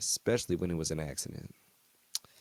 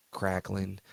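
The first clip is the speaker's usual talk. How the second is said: ordinary delivery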